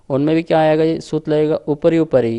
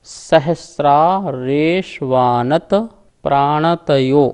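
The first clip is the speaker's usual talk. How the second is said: slow, louder recital, word by word; slow sutra recitation; 3 dB louder, much slower than usual. Shown as a demonstration. Teacher reciting the sutra slowly from the board. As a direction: intone every name from the board.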